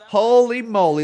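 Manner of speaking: ordinary talk, nothing marked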